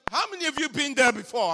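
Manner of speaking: repeating the question loudly to the congregation. Shown as a demonstration. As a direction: call out the question to the crowd. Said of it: "I'm asking".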